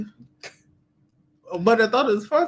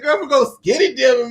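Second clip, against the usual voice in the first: laughing quote; 5 semitones higher, 4 dB louder than usual. Retold or imitated. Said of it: "She said".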